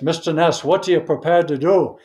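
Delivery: put-on Scottish accent pressing Mister Ness for an answer; Sean Connery Scottish accent. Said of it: "in a Scottish accent".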